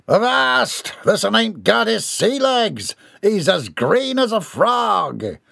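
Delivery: high, slow pirate jeer. Acted. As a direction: laugh at the landlubber's sea legs. Pirate mocks seasick boy.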